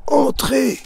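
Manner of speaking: very high, loud call from behind the door; shouted. Acted. Master calls the visitor in through the door, shouting. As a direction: bark the word through the door. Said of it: "On entend crier".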